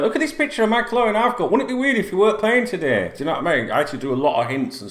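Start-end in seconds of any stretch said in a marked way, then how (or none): 0.0-3.1 high, fast, knowingly coy tease; winking hint. Retold or imitated. Fan hinting to forum members, cheeky knowing high tease. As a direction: drop a cheeky hint with a wink. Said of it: "winking at".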